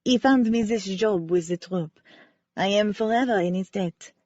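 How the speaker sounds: lightly voiced French ballerina, sincere; a hint of French accent, remembering gratefully. Ballerina Cece telling the amateur sleuth how Wynne saved her, light French accent.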